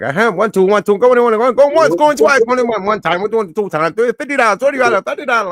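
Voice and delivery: high, loud rapid auction chant; auctioneer voice. Retold or imitated. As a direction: rattle off bids like an auctioneer.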